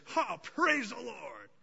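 high, drawn-out crazed praise; manic praise. Acted. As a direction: sound like a joyful madman. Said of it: "Habakkuk is an absolute maniac".

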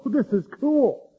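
high, slow mock tourist gush; lightly mocked awestruck visitor. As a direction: play a gushing sightseer. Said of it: "bring the cameras out and go".

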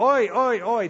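quick high "oi, oi, oi"; mock wincing cries; poked yelp. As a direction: yelp as if a tender spot is prodded. Acts out tender spots being poked.

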